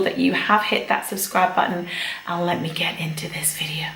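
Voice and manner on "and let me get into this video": whisper. Real, not acted. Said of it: "whispered sign-off to intro".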